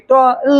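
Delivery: "ট, ল" broken into two drawn-out sounds; wrongly split word. Shown as a demonstration. Teacher asks if anyone reads so, "ট, ল" pulled apart. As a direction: hold "ট", then sound "ল" on its own. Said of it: "এনেকে পঢ়িব নেকি".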